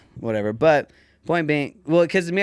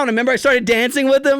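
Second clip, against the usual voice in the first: usual speaking voice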